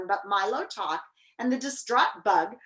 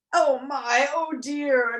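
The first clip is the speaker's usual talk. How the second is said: stuffed groan of dismay, higher voice; breathing with difficulty; 5 semitones higher, 5 dB louder, slower than usual. A narrator's reading. Minister agreeing after a huge feast, labored breathing. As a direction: heave the words out with difficulty. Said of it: "breathing with great difficulty".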